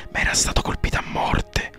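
plain reading voice

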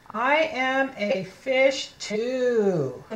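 very slow, long drawn-out final word; slow model reading. Shown as a demonstration. Teacher reading second fish page, very slow word-by-word. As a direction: stretch every word for beginning readers. Slow first take for children.

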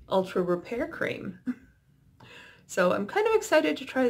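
face held still under mask; wearing a sheet mask. Real, not acted. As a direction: lips free, cheeks covered.

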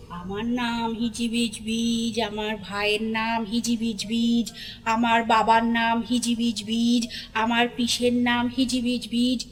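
slow, low, drawn-out sing-song monotone; droning recitation. A narrator's reading. Creature listing the family's names, slow droning chant. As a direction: chant each name on the same low note.

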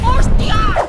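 a sudden loud startled cry; startled shout. Real, not acted. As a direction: jump at the scare and yell. Sudden shock in game. (grito de susto)

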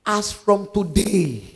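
preacher leading a prayer, drawn-out words, punched phrases; forceful prayer. Shown as a demonstration. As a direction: pray it out slowly and forcefully for them to repeat. Leads congregation in a prayer.

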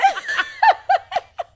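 high, loud burst of laughter. Real, not acted. Co-host laughing at a failed Irish attempt, loud high laugh.

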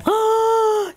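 sharp, high gasp of dismay. Real, not acted. Crafter loses the bunny's tail, dismayed gasp. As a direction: gasp as if something precious is gone. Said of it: "see how emotional I get".

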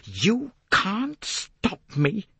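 high, slow, defiant cry. A narrator's reading. Jacqueline crying out at Poirot, defiant. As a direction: draw out each word as a dare. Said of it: "Then she cried out defiantly".

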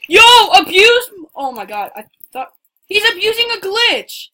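high indignant shout; outraged yell. Real, not acted. Outraged at glitch abuse.

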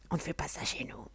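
whispered family rule, far off and secretive; hushed quote. Retold or imitated. Whispering the old family rule to viewers. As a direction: breathe it like a forbidden saying.

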